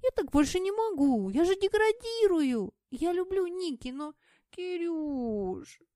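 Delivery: high, loud whining complaint; nasal whine. Acted. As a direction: whine it, pitched high and pleading. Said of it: "заныла Сэм".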